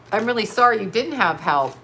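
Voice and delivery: normal talking voice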